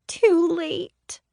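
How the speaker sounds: put-on high small voice of a little girl, ashamed; small chastened child. A narrator's reading. Little girl admitting to her aunt she wet herself, small and chastened. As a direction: shrink into a tiny ashamed child voice. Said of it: "came the reply in a small, chastened voice".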